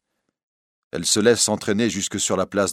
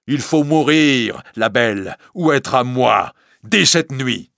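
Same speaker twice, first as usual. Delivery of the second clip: loud, raised, measured threat; menacing ultimatum; 5 semitones higher, 6 dB louder, slower than usual. Acted. Priest gripping the bohémienne, threatening fiercely. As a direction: lay down a passionate ultimatum.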